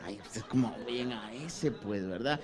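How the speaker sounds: a touch of quiet dismissive sneer; mild scoff. Retold or imitated. Churchgoers mocking how Paul sounds, lightly sneering. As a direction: mutter it with disdain.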